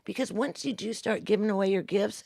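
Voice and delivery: ordinary talk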